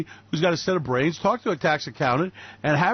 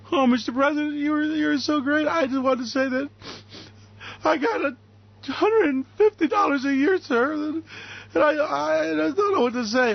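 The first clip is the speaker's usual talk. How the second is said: high, mock-grateful fan voice; gushing supporter; 8 semitones higher than usual. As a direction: falter as if overwhelmed with gratitude to the president.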